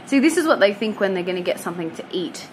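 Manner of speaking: ordinary talk, nothing marked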